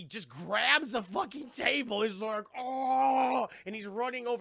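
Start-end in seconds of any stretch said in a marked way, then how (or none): 2.6-3.5 high, loud, drawn-out holler; imitated charging roar. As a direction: roar as the brute starting a charge. Imitates Fred's roar with table.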